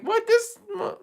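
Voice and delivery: high-pitched imitation of a baffled reaction; mock baffled listener. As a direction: squeak it as someone else's confusion. Mimics a listener's puzzled reaction.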